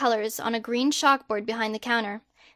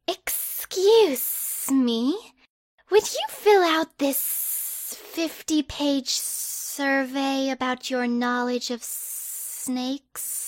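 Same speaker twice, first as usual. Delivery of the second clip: put-on creepy snake boy; much slower than usual. Slytherin boy slowly pitching his snake survey, slow and eerie. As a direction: draw each word out like a creeping snake.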